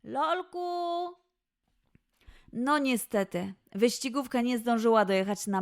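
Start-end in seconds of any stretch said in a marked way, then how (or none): 0.0-1.2 calling out. Acted. Mother calls boy sharply.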